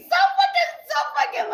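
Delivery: very high, loud squeal through laughter; laughing exasperation. Real, not acted. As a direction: sputter it out while cracking up. Breaks up after the impression.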